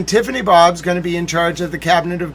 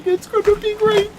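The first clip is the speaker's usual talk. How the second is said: much higher, cartoonish squeak; squeaky high voice. Bragging about the West Wing pool, squeaky high voice.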